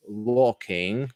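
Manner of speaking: "Walking" for working, British with no R sound; British accent. Shown as a demonstration. Teacher naming each version for students, British accent on "Walking". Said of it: "would be British".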